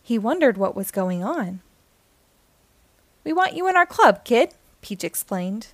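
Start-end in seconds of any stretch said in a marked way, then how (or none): 3.2-4.5 high, fast and loud; excited invitation. A narrator's reading. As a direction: pitch it up with excitement. Peach invites Nemo.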